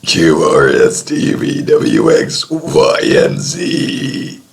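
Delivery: burping through the alphabet; burping the words. Pretend. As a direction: ride the burp through each letter. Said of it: "Gonna be burping the A B Cs".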